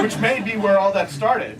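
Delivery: ordinary delivery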